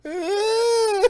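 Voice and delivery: high, loud held cry, imitating; mock pained wail. Retelling the kid stuck by his tongue, mock pained wail.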